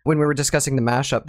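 ordinary delivery

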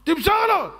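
high-pitched yell at the screen; mock fan shouting. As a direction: imitate a football fan urging the players on. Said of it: "וצועק לו".

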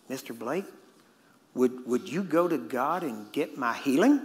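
slow, halting, carefully worded request; careful wording. Retold or imitated. Man asks preacher for healing, choosing words carefully. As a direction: feel out each word of a formal request. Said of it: "wanted to get the wording right".

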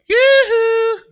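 very high, loud sing-song call; silly falsetto holler; mock calling out. Moses imagining hailing a rock, absurd high-pitched call.

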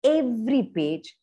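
plain reading voice

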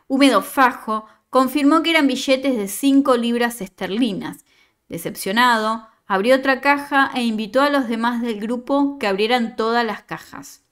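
steady storytelling read; story narration. Acted. Teacher reading a student's story to the class, narrating. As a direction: read the tale out to the class, evenly.

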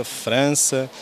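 ordinary delivery

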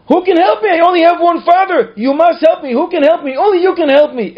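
very high, loud pleading; desperate yelling. Retold or imitated. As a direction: yell to heaven like a child begging. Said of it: "you start yelling".

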